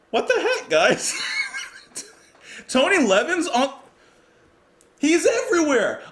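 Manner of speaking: sudden loud, high shout of delight; thrilled shout with a laugh; excited outburst. Real, not acted. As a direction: yell it out in happy disbelief. Surprised to hear [name].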